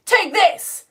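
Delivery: high, loud dramatic cry; hammed-up stage threat; stage shooter. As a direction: declaim it like an actor firing a gun. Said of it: "the woman comes on stage to do the shooting and it's like".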